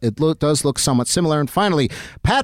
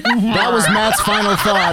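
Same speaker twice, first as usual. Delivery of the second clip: laughing; 7 semitones higher, slower than usual. Real, not acted. Laughing while wrapping segment.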